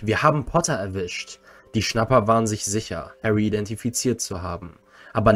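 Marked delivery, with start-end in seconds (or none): none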